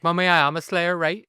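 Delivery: high, loud mock teen confession; mock Buffy. Pretend Buffy telling her mum, high and loud. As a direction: play Buffy blurting it out to mum.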